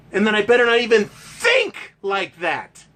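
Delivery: usual speaking voice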